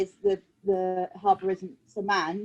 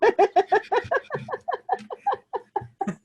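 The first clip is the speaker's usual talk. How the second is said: high laughter; 13 semitones higher than usual. Real, not acted. Laughing at the dragon voice slip.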